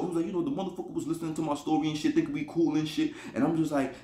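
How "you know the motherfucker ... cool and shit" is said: fast, softer impression of a friend; imitating friend's talk. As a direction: run it together like his storytelling. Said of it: "'cause this is how he talk".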